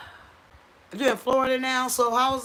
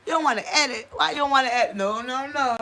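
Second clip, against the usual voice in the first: mock scolding; 6 semitones higher, 5 dB louder, faster than usual. Real, not acted. Scolding someone who won't edit.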